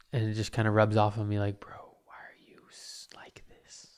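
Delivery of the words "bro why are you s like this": breathy whispered grumble. Retold or imitated. He mutters at a rude stranger, in a whisper. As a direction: hush it to yourself, unvoiced. Quoting his own sour mood.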